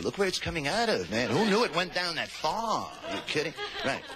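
normal talking voice

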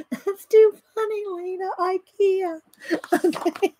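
nearly voiceless from laughing; weak laughter. Real, not acted. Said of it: "I'm still laughing".

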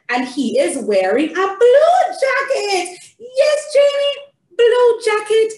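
high, delighted storytime sing-song; theatrical reading to a child. Shown as a demonstration. Reading the picture book with Janie, high and theatrical. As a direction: praise and point things out to a toddler.

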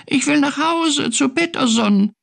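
very high little-cat plea; tiny high-pitched voice; peeping. A narrator's reading. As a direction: squeak it like a homesick kitten. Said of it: "piepste Findus".

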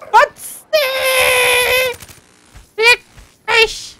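high, anguished cry of disbelief; frustrated shout. Real, not acted. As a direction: wail it at the screen. Gamer gets killed suddenly.

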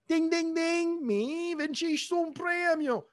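very high, sing-song game-show ding; mock jackpot bell drawn out into words; prize announcement. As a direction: squeal out a mock prize win. Imitates a prize-winning bell.

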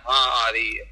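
low, slow half-asleep mumble; drowsy murmur of contentment; sleepy murmur. Retold or imitated. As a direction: drift off while saying it. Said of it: "ਸੁੱਤੇ ਸੁੱਤੇ ਵੀ ਹੱਥ ਸੁੰਘਦਾ ਰਿਹਾ".

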